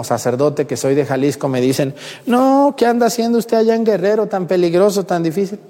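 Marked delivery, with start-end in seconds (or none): 2.3-5.6 high-pitched imitation of fussing acquaintances; hammed-up worried questioning; mock alarmed disbelief. Acted worried people questioning the priest.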